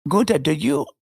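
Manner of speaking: reminiscing German man, mock accent; imitating German tourist. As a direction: put on a comic German accent. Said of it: "I can just hear them now".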